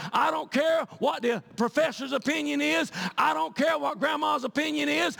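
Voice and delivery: loud, high sermon at full force; fiery preaching. Acted. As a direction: preach it hard to the congregation.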